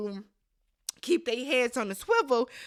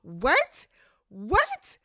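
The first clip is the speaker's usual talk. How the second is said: can't believe what she just saw; outraged disbelief; 3 semitones higher, 5 dB louder than usual. Retold or imitated. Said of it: "I said".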